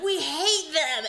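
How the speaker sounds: high, loud, drawn-out mock protest; passionate child's outburst. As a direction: imitate a fierce kid, slowly, through laughter. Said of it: "When she feels very passionately about something".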